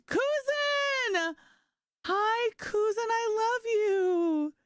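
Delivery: high, drawn-out sing-song greeting; excited greeting. Real, not acted. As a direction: stretch it out, squealing with delight. Greeting a chat friend.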